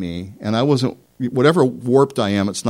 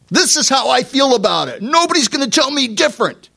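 high, defiant know-it-all; mock stubborn high voice; stubborn self-willed voice; 13 semitones higher, 3 dB louder than usual. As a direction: play a proud stubborn man. Voicing a stubborn self-willed person.

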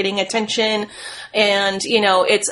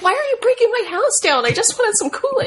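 whiny homeowner; 13 semitones higher than usual. Pretend. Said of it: "It's like".

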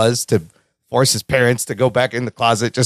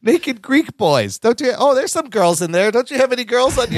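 raised, prim lady quote; mock society lady; 9 semitones higher than usual. Friends quoting the dinner scene in a raised prim voice. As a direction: lift it into a fussy lady.

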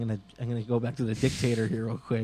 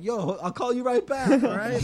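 much higher casual phone voice; mock phone voice; 10 semitones higher, 3 dB louder than usual. Dictator on the phone, high mock casual. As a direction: brush off the caller breezily. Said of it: "talking into the vag".

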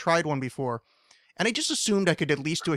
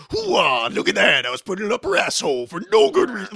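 loud, high, gruff hoo-ah shout; gruff Al Pacino voice; 6 semitones higher, 6 dB louder than usual. Retold or imitated. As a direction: bark it out like a hammy Pacino. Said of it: "make your voice sound all gruff".

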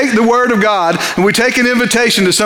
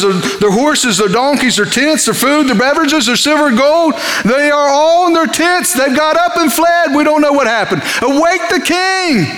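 rising urgent report; 6 semitones higher than usual. Retold or imitated. Lepers urging the gatekeeper to wake the king, building urgency. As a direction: pile it up until you demand the king.

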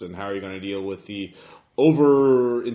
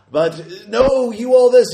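booming roar, much higher and louder; booming arrogant alien overlord; 14 semitones higher, 11 dB louder than usual. Acted. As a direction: thunder at the crowd. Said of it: "that same booming voice".

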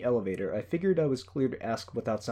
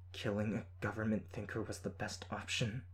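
tight voice; 8 dB quieter than usual. A narrator's reading. Heroine questioning a captive teammate about a killing, tight voice. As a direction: ask it quietly, tautly controlled. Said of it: "Her voice was tight as she asked".

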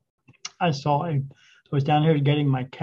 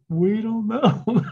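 baffled; 7 semitones higher, 4 dB louder, much slower than usual. Retold or imitated. Investigators baffled by orbs.

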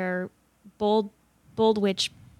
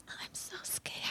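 breathy mock fright, whispered; mock scared whisper; 21 dB quieter than usual. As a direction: whisper it in fake fear. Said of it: "that's the part where she's like".

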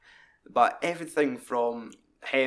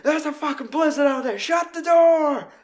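much higher, loud yelled order; raging shout; 7 dB louder than usual. Retold or imitated. Joe Gage yelling at newcomers to shut the door. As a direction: bellow it over the storm. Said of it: "Joe Gage is raging".

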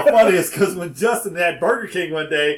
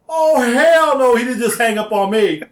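loud, high, drawn-out comic indignation; indignant outburst; 7 semitones higher, 5 dB louder than usual. Retold or imitated. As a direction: stretch it out in mock outrage. Reacting to being hung up on.

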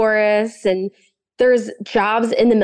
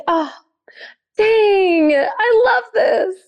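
excited outburst; 11 semitones higher, much slower than usual. Retold or imitated. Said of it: "And I was just like".

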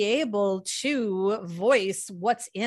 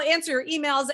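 ordinary delivery

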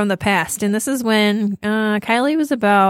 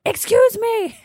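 much higher, shouted excuse me; exasperated shout; 13 semitones higher, slower than usual. Retold or imitated. Server finally yelling at Bruce Jenner to move, mock shout. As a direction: finally yell to get through. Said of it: "She kept saying it louder and louder".

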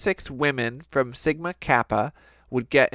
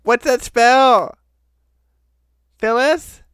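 cheerleader call; 9 semitones higher, 6 dB louder than usual. Pretend. End of cheer.

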